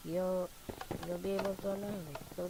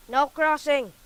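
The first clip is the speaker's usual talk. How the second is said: shouting; 8 semitones higher, 14 dB louder, slower than usual. Real, not acted. Blocking opponent in game.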